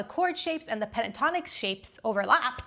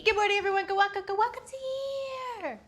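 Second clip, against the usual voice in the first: high host greeting; 9 semitones higher, faster than usual. Acted. Playful high show greeting.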